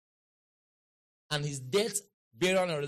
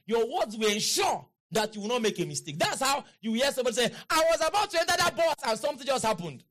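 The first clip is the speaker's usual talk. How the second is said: raised, driving pulpit voice; forceful preaching; 5 semitones higher, 4 dB louder than usual. Real, not acted. Preacher telling how your words protect you, pitch climbing into forceful preaching. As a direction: drive the point home, pitch climbing.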